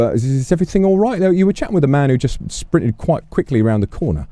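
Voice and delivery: a hint of a nervous bobby checking in; polite officer.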